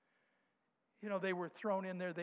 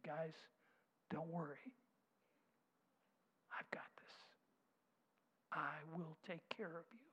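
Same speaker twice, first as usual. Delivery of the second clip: soft, hushed comfort; gentle whispered promise; tender hush; 2 semitones lower, 9 dB quieter than usual. Acted. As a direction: lean in and reassure them quietly. Christ comforting the three men.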